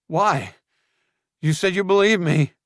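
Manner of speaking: high, loud desperate protest; Julian panicking. Acted. Julian panicking at Luke's threat, high and loud. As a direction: plead in sudden panic.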